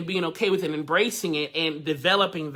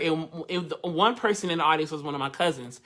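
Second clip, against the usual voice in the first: ordinary talk, nothing marked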